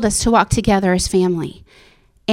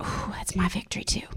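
soft, breathy hush of wonder; awed whisper; 2 semitones lower, 14 dB quieter than usual. Retold or imitated. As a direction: whisper it like a secret realisation. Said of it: "I was like".

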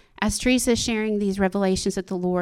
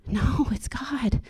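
slow, hushed whisper; whispered answer; 3 semitones higher, 4 dB quieter, much slower than usual. Real, not acted. Telling the church who changes the nation, a slow whisper. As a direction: let it fall out slow and barely voiced. Answering her own question.

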